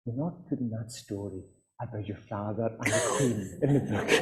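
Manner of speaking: hushed, secretive imitation of an elderly cousin; very soft, confiding mock whisper. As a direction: whisper it like a scandal to keep quiet. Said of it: "she even whispered it to me".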